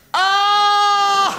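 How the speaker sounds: sudden high yelp as he sinks into the beanbag; startled yelp. Acted. Man sinking into the neighbours' beanbag, startled yelp. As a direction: let out a shocked cry while dropping down.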